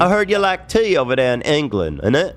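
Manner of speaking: mock British accent. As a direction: tease in a silly British accent. Teases English tea drinkers in character.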